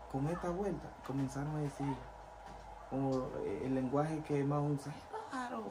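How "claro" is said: high, softer mocking gossip; gossiping townsfolk. Townspeople gossiping that the pastor left church, high mocking. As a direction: sneer it up high like town gossip.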